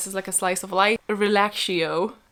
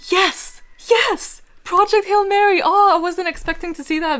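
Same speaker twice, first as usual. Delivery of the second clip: high, loud burst of excitement; overjoyed surprise, pitch leaping up; very excited; 9 semitones higher, 5 dB louder than usual. Real, not acted. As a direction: cry out in real delight at a wished-for find. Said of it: "I'm very excited".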